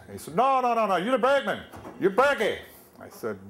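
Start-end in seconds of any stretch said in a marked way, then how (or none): 0.4-2.6 loud, much higher Pennsylvania Dutch bark; Pennsylvania Dutch shout. Retold or imitated. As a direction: shout it at the new guy in a thick Pennsylvania Dutch accent. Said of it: "they talk funny and they shout".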